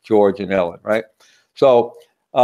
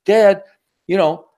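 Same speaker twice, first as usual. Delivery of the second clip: put-on high child's voice, fast protest; the boy defends his dad against mom; kid talking back; 8 semitones higher than usual. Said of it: "So little Joey goes".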